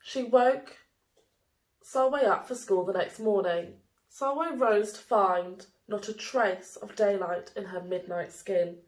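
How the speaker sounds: plain reading voice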